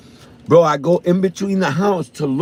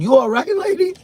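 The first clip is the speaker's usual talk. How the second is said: startled high call. Retold or imitated. Finding the neighbor crouched by the shed, startled high call. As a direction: call out in alarm, pitched way up.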